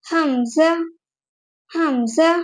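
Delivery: حمزہ ending in a clear breathy h; حمزہ with a rising final syllable; hamza with final h. Shown as a demonstration. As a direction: let the ha be heard at the end of حمزہ. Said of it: "حمزہ میں اینڈ میں ہا کی آواز آتی ہے".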